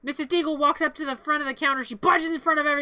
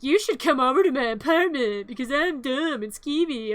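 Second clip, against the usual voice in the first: sleazy Gerald. Retold or imitated. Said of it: "And then Gerald's like".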